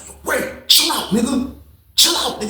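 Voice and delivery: high, soft urgent plea; nervous pleading. Retold or imitated. Narrator pleading with the angry friend to calm down, high. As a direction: coax him to calm down, high. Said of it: "I was like".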